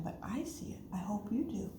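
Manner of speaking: soft conspiratorial whisper; secretive whisper. Pretend. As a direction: let children in on a secret. Sharing a secret with listeners.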